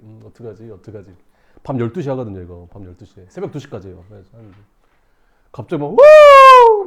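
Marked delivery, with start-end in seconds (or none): 0.0-1.1 very soft, low worried self-talk; hushed worry. Retold or imitated. Waiting recruit dreading his turn, hushed. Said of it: "조용해지는 거야". 5.9-6.9 long, high, loud hoot; eerie bird call. Retold or imitated. Teller imitating a bird in dark woods, high hooting. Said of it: "새소리 들리고".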